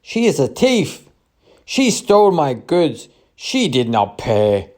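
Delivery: high, loud cry of outrage; loud accusing shout. A narrator's reading. Market trader accusing a woman of theft, shouting loudly. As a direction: shout the accusation, pitch leaping up then falling. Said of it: "shouting loudly".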